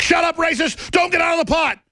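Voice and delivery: high, strained mock yell; mock shout. Captors ordering the patriot back, mock shouting. Voices captors yelling as he escapes.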